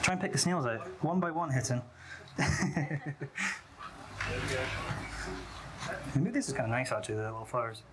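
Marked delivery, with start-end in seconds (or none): 2.1-6.1 long, soft laugh; breathy chuckle. Real, not acted. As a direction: chuckle quietly at length. Amused at picking out snails.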